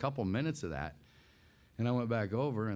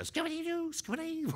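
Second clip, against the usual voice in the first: very high little creature chant; high creature chant from a toy. Acted. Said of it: "or whatever I say there".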